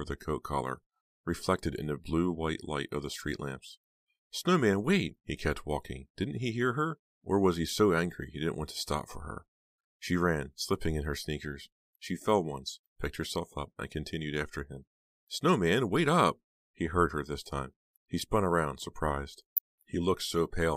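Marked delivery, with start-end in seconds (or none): 4.3-5.2 high, loud call across the street. A narrator's reading. Heather calling to Snowman down the street, high and loud. Calling after him in snow. 15.3-16.4 high, loud call across the street. A narrator's reading. Heather calling to Snowman down the street, high and loud. Calling after him again.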